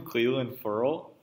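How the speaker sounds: Clelin said KLEE-lin, Ferrell said FURL, rising as a question; questioned pronunciation. Shown as a demonstration. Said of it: "How did you say his name?".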